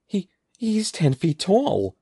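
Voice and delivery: high, slow stammering exclamation; alarmed shout. A narrator's reading. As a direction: blurt it out in high-pitched disbelief. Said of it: "Evan heard Andy shout".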